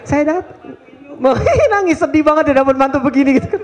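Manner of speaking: high, laughing through a joke about the father; laughing tease. Real, not acted. Preacher teases a friend about crying at a wedding, laughing. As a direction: giggle while teasing a friend. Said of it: "Jadi di belakang aja tadi".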